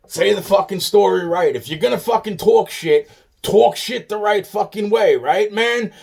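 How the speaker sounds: loud, high, angry lecturing; angry demand. Real, not acted. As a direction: yell at them to tell it right. Angrily demanding the story be told right.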